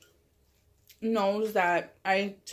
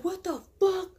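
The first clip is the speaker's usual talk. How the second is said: much higher outraged mock yell; outraged uncle; 10 semitones higher than usual. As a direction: voice the furious uncle, pitched way up. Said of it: "And my uncle's like".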